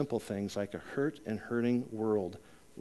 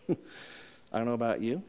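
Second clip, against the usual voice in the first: slower, laughing through the line; teasing laugh; 3 semitones lower, 4 dB quieter, slower than usual. Real, not acted. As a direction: tease the congregation with a chuckle.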